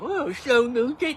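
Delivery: high, slow mocking sneer; mocking high commenter voice. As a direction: mimic the snide commenter, sing-song. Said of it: "That was annoying though".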